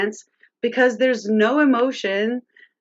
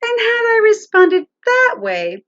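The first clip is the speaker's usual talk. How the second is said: normal talking voice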